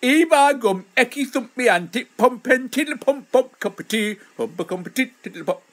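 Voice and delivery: high, hammed-up Yorkshire gibberish; mock Yorkshire accent. Streamer joking about his accent, hammed-up Yorkshire patter. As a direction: burst into bouncy nonsense in broad Yorkshire. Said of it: "Yorkshire accent, smart ass Yorkshire sense of humour".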